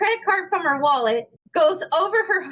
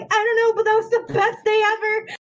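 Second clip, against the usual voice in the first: laughing with glee; 6 semitones higher than usual. Retold or imitated. Gleeful answer to teacher.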